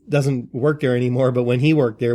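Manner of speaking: ordinary delivery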